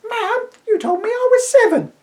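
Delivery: put-on a small boy puzzled by his mother; little boy. Boy in the story voiced.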